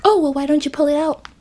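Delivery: high, quick mimicked voice; imagined naive questioner. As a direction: imitate a clueless suggestion in a high pitch.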